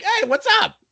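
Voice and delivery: high, casual imitation of a musician; unassuming greeting. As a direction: toss off a put-on high hello. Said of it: "He's so unassuming about that shit and just like".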